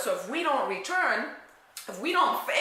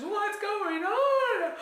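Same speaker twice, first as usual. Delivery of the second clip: higher and slow, half laughing; stretched-out laughing disbelief; mock alarmed; 11 semitones higher, much slower than usual. Woman baffled by her own hot flashes, stretched and laughing.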